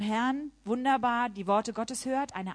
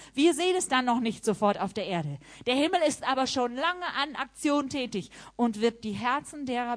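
raised, urging preaching voice; exhorting sermon; 4 semitones higher, 4 dB louder than usual. Acted. Preacher urging the congregation to forgive, raised and insistent. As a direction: press the listeners to forgive.

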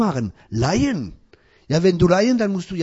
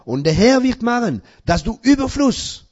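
rising preaching; 6 semitones higher, 6 dB louder than usual. Acted. Preacher rising in scripture reading.

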